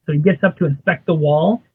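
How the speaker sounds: ordinary talk, nothing marked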